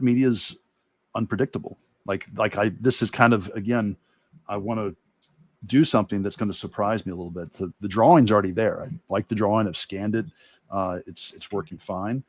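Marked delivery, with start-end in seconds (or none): none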